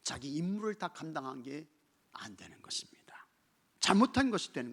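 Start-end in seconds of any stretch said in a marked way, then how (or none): 2.1-3.3 hushed whisper. Acted. Whispered conclusion.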